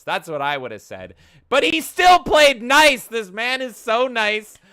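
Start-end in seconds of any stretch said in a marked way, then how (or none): 1.5-4.6 exasperated shout. Real, not acted. Ranting about the nice customer.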